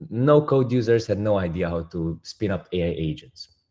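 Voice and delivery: ordinary talk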